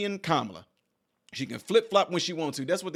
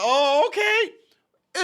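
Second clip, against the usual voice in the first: very slow, very high unimpressed okay; 13 semitones higher, 4 dB louder, much slower than usual. Retold or imitated. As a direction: drag it out high and doubtful. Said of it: "And we all like".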